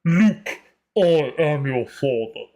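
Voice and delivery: slow, weighty movie-villain voice; Darth Vader. Shown as a demonstration. Practicing the deepest voice, slow villain impression. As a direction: punch the name, then sink low and drawn out. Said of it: "I've got Darth Vader".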